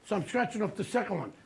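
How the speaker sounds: ordinary delivery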